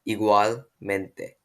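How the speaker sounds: igualmente very slowly, split as igual-mente; drawn-out, careful igualmente with a break before mente; slow model pronunciation. Shown as a demonstration. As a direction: sound out igualmente for a learner. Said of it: "repeat after me".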